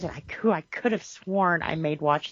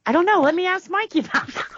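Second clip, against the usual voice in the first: high, loud playful exasperation; playful helpless; 11 semitones higher, 5 dB louder, faster than usual. Retold or imitated. Mom answering son's hard questions, mock helpless. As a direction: throw up your hands, high and loud.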